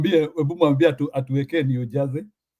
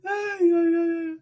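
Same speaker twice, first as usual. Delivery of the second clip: laughing wail; much slower than usual. Real, not acted. Laughing at a pronunciation lesson.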